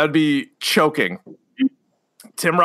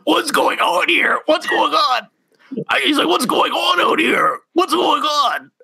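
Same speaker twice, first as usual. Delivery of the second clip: mock alarmed; 8 semitones higher than usual. Voicing sketch character's alarm.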